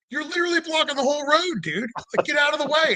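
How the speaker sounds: high-pitched, incredulous retold protest; indignant protest. Driver arguing with cop blocking road, indignant.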